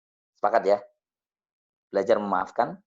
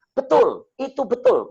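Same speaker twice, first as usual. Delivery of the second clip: very high, louder, overwrought "betul"; stressed widow; 12 semitones higher, 5 dB louder, slower than usual. Retold or imitated. Grieving widow confirming the prayer, high and overwrought. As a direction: cry it out high like someone at breaking point. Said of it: "kayak orang stres waktu itu".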